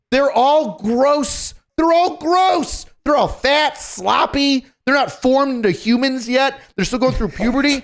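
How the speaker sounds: high-pitched, exasperated disgust; comically exasperated and grossed out; disgusted rant. Real, not acted. Exasperated rant about trainees, listeners laughing.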